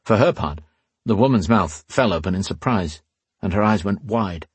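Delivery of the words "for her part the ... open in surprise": ordinary delivery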